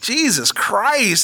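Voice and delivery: slow, fed-up groan, voice high; exasperated. Real, not acted. Ranting to his co-host about Alabama, slow and exasperated. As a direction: drag it out like you're sick of them.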